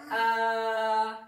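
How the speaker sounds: a drawn out, almost sung; "a" allongé, presque chanté; long a. Shown as a demonstration. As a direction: hold the a long. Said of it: "il est là pour prolonger le e".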